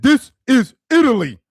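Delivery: loud, high, indignant "This is Italy"; angry Italian friend. Retold or imitated. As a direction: bark it like a furious proud Italian. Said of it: "That's exactly how he said it".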